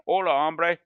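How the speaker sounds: a tourist's cheerful fake Spanish; mock Spanish. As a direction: play at speaking Spanish like a gringo. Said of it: "pretending like I can speak Spanish".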